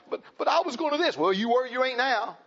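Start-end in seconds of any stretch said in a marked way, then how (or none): 0.1-1.2 high whiny stammer; mock whiny excuse. Preacher mocking a young mother's excuse, high whine. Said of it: "this mess today of like".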